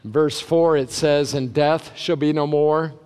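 ordinary talk, nothing marked

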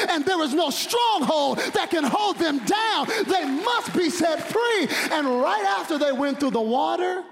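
high, shouted sermon climax; preacher at full climax; preaching at full force. Acted. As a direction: shout it from the pulpit.

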